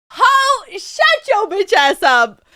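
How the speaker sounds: high, loud playful scolding; drawn-out mock yell at a friend; mock-abusive yell. How she talks to best friend, mock-abusive yell. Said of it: "That's how I talk to her".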